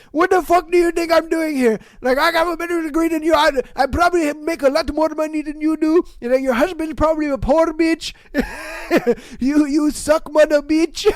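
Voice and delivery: high, loud fast tirade; mock angry rant. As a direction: rip into her, fast and loud. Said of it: "I'd be like".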